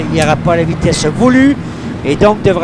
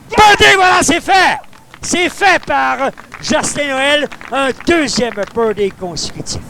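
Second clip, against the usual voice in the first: excited birdie call; 9 semitones higher than usual. Real, not acted. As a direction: burst out as the putt drops.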